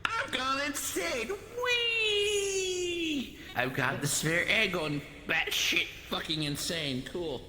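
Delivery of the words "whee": ordinary talk, nothing marked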